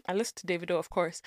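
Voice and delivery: soft, low, offhand reply; quick low aside of agreement; retold casual agreement. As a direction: toss it off under your voice. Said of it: "I was like, "Oh, yeah, yeah, I know".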